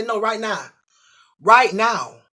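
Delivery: loud, high insistence; no arguing, right now; demanding. Retold or imitated. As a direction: shout the order at the man.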